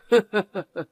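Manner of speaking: a short mocking laugh at her; gloating little laugh; smug chuckle. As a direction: chuckle down at her, pleased with yourself. Mocking after stealing wallet.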